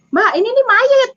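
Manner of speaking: jumpy, high-pitched insistence; alarmed exclamation. Retold or imitated. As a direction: imitate a startled driver blurting it out. Retold driver alarmed at seeing corpse.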